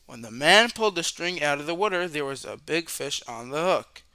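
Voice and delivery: ordinary delivery, nothing marked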